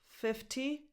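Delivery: normal talking voice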